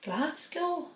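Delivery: high, soft stab at Scottish; badly faked Scottish lilt on one word; bad Scottish accent. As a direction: have a hesitant go at Scottish. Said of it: "I can't do a Scottish accent".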